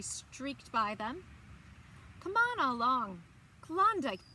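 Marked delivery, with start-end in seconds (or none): 2.2-4.2 mild chortle. A narrator's reading. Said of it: "Berta chortled".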